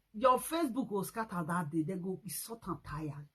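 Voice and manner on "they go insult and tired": ordinary talk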